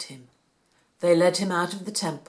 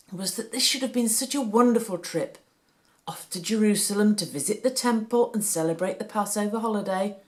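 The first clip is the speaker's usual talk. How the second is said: ordinary delivery, nothing marked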